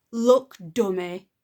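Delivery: loud, slow contemptuous jab; scornful bratty girl; rude. Acted. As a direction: talk down to him. Rude girl insults father.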